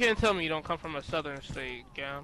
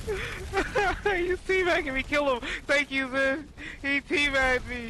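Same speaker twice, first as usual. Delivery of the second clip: much higher, louder, slow outraged shriek; 11 semitones higher, 3 dB louder, much slower than usual. Real, not acted. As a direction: shriek it out, stunned at being teabagged. Enemy teabags him after dying.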